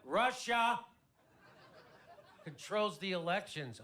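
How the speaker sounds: loud, high mock disbelief, broken by a pause; hammed-up astonished repeat; incredulous echo. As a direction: throw the claim back, RUSSIA shouted high. Incredulous echo of brother's claim.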